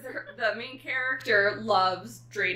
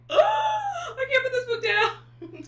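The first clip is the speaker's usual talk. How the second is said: high, loud delighted gush; squealing with delight over a book; gushing excitement; 8 dB louder, slower than usual. Retold or imitated. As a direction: rave like you can't stop reading. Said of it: "and I was like".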